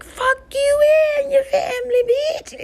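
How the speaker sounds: put-on very high, loud little-girl scream; screaming meme girl. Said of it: "she screams and yells in the fucking camera".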